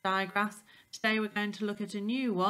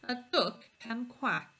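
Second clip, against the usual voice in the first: usual speaking voice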